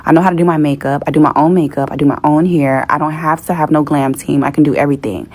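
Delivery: usual speaking voice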